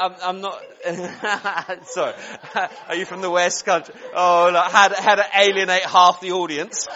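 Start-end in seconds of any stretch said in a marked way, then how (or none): 0.1-2.7 real embarrassed laugh breaking into the words; laughing apology, higher than usual. Real, not acted. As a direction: crack up while backtracking. Cracks up over West Country gaffe.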